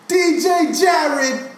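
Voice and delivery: high, slow hype shout; excited shout. Real, not acted. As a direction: call it out like a hype man. Hyping up the DJ.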